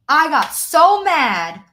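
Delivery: loud, slow, genuinely worked up; angry. Real, not acted. Coach telling her live audience how mad she got. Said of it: "I'm getting emotional".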